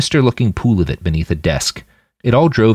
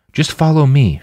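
a touch louder, higher, forcing a sure tone; bluffing calm leader; put-on confidence; 7 semitones higher, 4 dB louder than usual. A narrator's reading. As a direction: sound braver than she feels. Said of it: "with more confidence than she felt".

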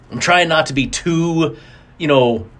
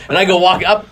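usual speaking voice

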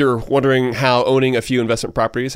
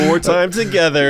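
teasing through laughter, higher; laughing joke; 6 semitones higher, 3 dB louder than usual. Real, not acted. Co-host teasing Chad about dating his Spanish teacher, laughing. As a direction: chuckle out the punchline.

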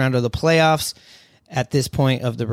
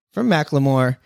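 ordinary talk, nothing marked

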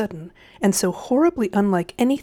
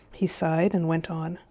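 usual speaking voice

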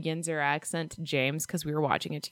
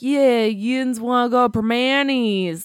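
slow, drawn-out Pittsburgh accent; 5 semitones higher, 7 dB louder, slower than usual. Shown as a demonstration. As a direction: ham up a Yinzer accent. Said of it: "I try to do, like, a Pittsburghese accent".

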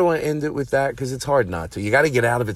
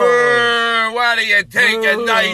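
disgusted; 10 semitones higher, 6 dB louder, slower than usual. Real, not acted. Disgusted outburst.

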